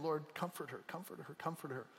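soft, fading, hushed murmur; hushed repeated prayer; quiet prayer. Retold or imitated. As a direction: pray under your breath. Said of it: "I was just praying".

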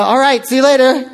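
high, chirpy goodbye breaking toward a laugh; oblivious friendliness. Retold or imitated. Student who kept walking past bad news, obliviously friendly. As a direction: sign off brightly, having heard nothing.

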